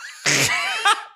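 hell auflachen; kurzes Lachen. Real, not acted. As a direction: lach kurz los. Lacht über Riesa. (kurzes helles Lachen)

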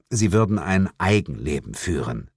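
ordinary delivery

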